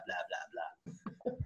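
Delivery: dissolving into a breathless laugh; laughing blah. Real, not acted. Quizmaster skipping the question text, laughing. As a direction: let the blah collapse into giggles.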